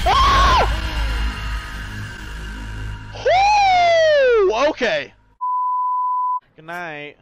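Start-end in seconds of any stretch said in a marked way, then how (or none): 0.1-0.7 scream. Real, not acted. Jump scare scream. 3.2-4.7 yelp. Real, not acted. Startled yelp.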